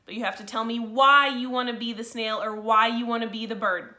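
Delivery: plain reading voice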